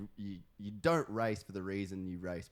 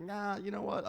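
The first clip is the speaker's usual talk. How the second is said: high mock voice; 12 semitones higher than usual. Retold inner voice giving up.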